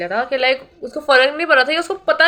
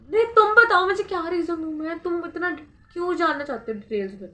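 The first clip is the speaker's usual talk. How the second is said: higher, pushy challenge to the examiner; mock confrontational; 5 semitones higher than usual. To the exam paper, mock confrontational. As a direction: demand an answer, half-joking.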